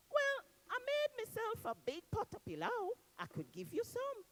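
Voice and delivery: high, sweet woman's voice; Miss Daisy's voice. Acted. Miss Daisy greets Anansi at her house, high voice. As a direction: greet him as the lady of the village.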